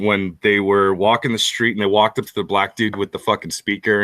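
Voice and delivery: usual speaking voice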